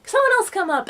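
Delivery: high, breezy show-host call; clown calling out. Retold or imitated. Clown calls next child up.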